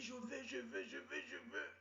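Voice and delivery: high, whining I want; whiny girlfriend. Retold or imitated. Girlfriend begging her boyfriend, high and whiny. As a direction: whine it up high like a needy girlfriend. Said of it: "Elle dit".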